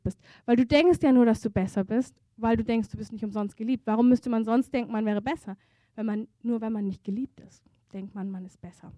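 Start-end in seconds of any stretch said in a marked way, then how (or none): none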